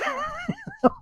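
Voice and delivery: high burst of laughter; real laugh. Real, not acted. Amused host cracking up at a drug survey question, laughing. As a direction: crack up mid-thought.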